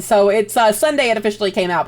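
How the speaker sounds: usual speaking voice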